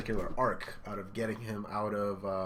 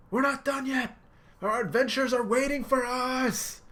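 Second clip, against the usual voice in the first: high, loud call; shouting; 7 dB louder than usual. Retold or imitated. Luffy shouting his promise to Sanji=118. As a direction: yell it after him.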